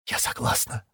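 voiceless, tense whisper. A narrator's reading. Шира consents to losing her arm, strained whisper. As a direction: breathe consent in a faint whisper. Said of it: "прошептала Шира".

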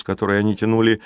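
usual speaking voice

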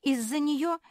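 high, loud grievance; indignant complaining. A narrator's reading. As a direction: tattle loudly in outraged protest. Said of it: "хором стали жаловаться".

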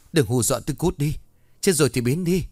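scared man yelling at a ghost, building to a shout; panicked shout. A narrator's reading. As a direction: shout her away in panic. Terrified man yells at ghost.

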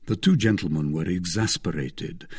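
plain reading voice

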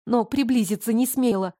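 usual speaking voice

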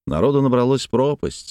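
ordinary delivery, nothing marked

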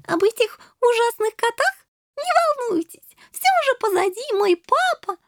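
put-on very high girlish chatter; chirping little princess. A narrator's reading. Shelli telling boys not to worry, chirping high voice. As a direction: twitter away without a care.

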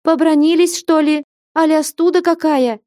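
high, loud demanding cry; shouting. A narrator's reading. Flyonushka pressing Alexei about a quarrel, high loud cry.

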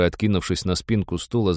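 ordinary delivery

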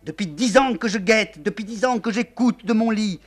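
wife screaming at the general; hysterical screaming. Acted. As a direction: howl it out in fury. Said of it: "La générale hurle".

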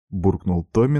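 ordinary delivery

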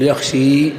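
يخشي with a full i, like يرمي; wrong full i. Shown as a demonstration. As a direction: say يخشي ending in pure i, yakhshi. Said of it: "فينقلب الألف إلى ياء".

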